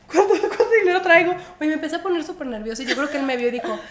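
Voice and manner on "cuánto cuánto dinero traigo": higher, louder nervous stammer; flustered. Retold or imitated. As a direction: stumble over it nervously. Nerviosa ante pregunta del agente. (nerviosa tartamudeando ante el agente)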